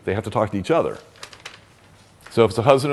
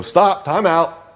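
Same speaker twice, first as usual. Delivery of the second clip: high, slow, drawn-out stop; calling time out; 8 semitones higher, 4 dB louder, much slower than usual. Shown as a demonstration. Therapist stops the triggered couple, slow and raised. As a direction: halt the fight like a referee.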